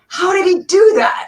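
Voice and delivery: high, loud astonished cry; amazed shout. Retold or imitated. Actress amazed at the banana trick, shouting high. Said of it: "I went".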